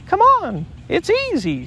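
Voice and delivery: very high, loud squeak; squeaky Roo. A narrator's reading. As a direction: squeak encouragement up the tree. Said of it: "squeaked Roo".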